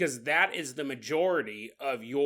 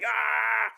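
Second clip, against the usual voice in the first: hammed-up, raised cry of pain; mock birthing scream; 6 semitones higher, 3 dB louder than usual. Alien wife bursting with babies, mock scream. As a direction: scream as if giving birth, jokingly.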